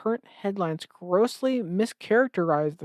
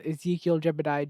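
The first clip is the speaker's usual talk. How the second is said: ordinary talk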